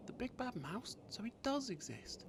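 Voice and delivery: put-on high, wide-eyed child voice; amazed child. Gruffalo's child learning the mouse is real, high amazed voice. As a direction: gasp it out in wonder.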